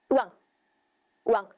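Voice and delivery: short mock newborn wail; lightly voiced newborn cry; imitated baby cry. A narrator's reading. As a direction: voice the baby crying in short bursts.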